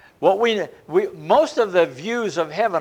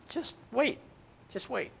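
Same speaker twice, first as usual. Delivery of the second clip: much softer, calming hush; gentle reassurance; 7 semitones higher, 9 dB quieter than usual. Acted. God calming the impatient martyrs, softly. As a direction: soothe them gently. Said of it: "and God says".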